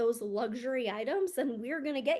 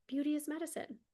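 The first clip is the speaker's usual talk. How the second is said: dreamy echo; 5 semitones higher than usual. Shown as a demonstration. Coach repeating her mantra to viewers, higher and dreamy. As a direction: echo it back high and savoring.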